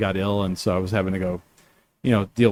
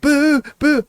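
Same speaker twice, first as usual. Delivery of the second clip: much higher, mock-indignant crowd voice; outraged crowd; slower than usual. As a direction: play the scandalised fans crying foul.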